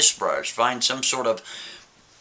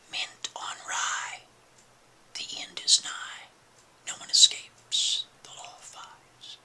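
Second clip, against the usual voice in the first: slow ghostly whisper from the painting; eerie whisper; 8 dB quieter, slower than usual. Acted. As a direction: breathe it out like a haunted voice.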